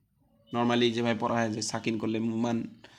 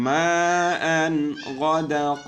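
Quran recitation; 4 semitones higher, 8 dB louder, much slower than usual. Shown as a demonstration. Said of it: "teacher demonstrates recitation".

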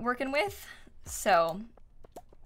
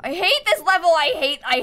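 high, loud exasperated cry; frustrated outburst; 8 semitones higher, 7 dB louder than usual. Real, not acted. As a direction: vent at the level.

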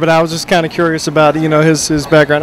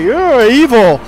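mock accusation; 5 semitones higher, 4 dB louder, much slower than usual. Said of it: "throw a cross up and say".

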